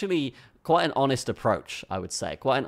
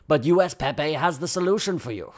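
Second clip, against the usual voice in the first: higher-pitched, put-on English accent; 5 semitones higher, 3 dB louder than usual. Reading the coin's sales pitch to viewers, put-on English accent. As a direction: play up a mock English accent. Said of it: "doing it with an English accent".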